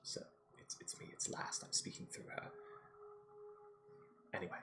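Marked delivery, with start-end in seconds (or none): 0.0-2.4 soft unvoiced whisper. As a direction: whisper it secretly through her. Last whispers through the creature.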